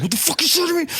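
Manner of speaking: high, strained mock yell; retold angry yelling. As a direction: imitate the irate rider in a high voice. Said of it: "He's yelling at me".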